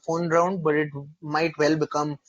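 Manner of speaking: normal talking voice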